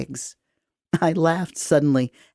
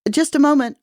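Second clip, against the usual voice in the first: high, loud call through the door; yelled; 11 semitones higher, 5 dB louder than usual. Acted. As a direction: shout it to whoever's knocking. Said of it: "I yelled".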